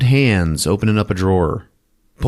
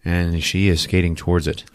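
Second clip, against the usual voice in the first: usual speaking voice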